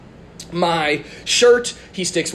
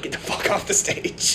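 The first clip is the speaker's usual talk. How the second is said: hushed aside; 2 semitones higher, 6 dB quieter than usual. Retold or imitated. Magician's aside on stage.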